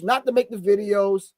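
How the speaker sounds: ordinary delivery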